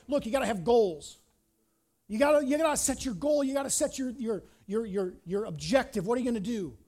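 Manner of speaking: hammed-up imitation of an insistent goals guru; pushy goal-setter. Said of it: "The other one is like".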